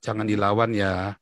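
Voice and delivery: low, slow fatherly caution; father's advice. Retold or imitated. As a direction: voice a father gently holding his child back. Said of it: "ayah saya selalu bilang apa".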